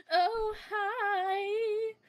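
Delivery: high, loud, exaggerated greeting; mocking impression. Player mimics an idiot character's greeting, mocking. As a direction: imitate her with a piped-up hello. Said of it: "That's my impression of her".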